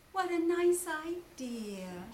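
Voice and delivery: slow, motherly and high; slow kind mother; Kanga voice. Acted. Kanga praising the children, slow motherly voice.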